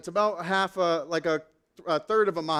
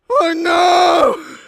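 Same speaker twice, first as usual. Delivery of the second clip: much higher, louder cry of dread; dismayed shout; 9 semitones higher, 13 dB louder than usual. Retold or imitated. Pastor told the ride was only a warm-up, loud comic shout. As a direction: yell it in comic horror. Reacts to more laps coming.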